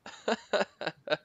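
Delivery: quick burst of laughter; short laugh. Real, not acted. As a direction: chuckle. Player joke.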